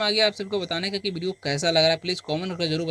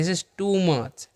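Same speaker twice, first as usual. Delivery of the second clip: धीमा स्पष्ट उच्चारण; much slower than usual. Shown as a demonstration. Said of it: "तो बोलेंगे".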